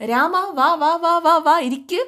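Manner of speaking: high, bright calling-in of a guest; excited host calling a friend inside; eager welcome. Retold or imitated. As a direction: wave him in with eager repeated "come". Father welcomes visitor warmly.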